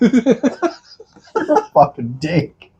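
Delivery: slow words through laughter; laughing. Real, not acted. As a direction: squeeze it out while cracking up. Both cracking up.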